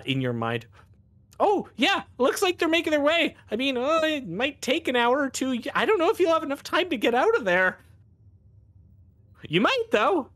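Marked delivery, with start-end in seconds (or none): 1.4-7.8 much higher, quick, chatty voice; a distant helper's casual voice; psychic voice in the mind. Acted. Hear the voice come back. 9.5-10.3 higher, hopeful afterthought; lightly voiced helper. Voice in the mind continues.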